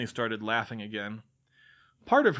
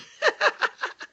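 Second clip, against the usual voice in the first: very high falling cackle; shrill mad cackle; 5 dB louder than usual. Acted. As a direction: let a mad shriek of laughter fall away. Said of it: "Crouch cackled".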